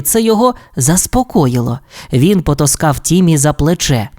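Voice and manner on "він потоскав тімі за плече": plain reading voice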